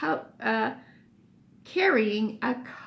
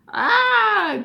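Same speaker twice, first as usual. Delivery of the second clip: loud high gasp of disgust; disgusted cry; 11 semitones higher, 10 dB louder than usual. Acted. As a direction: recoil with a loud ah. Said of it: "cried Billy".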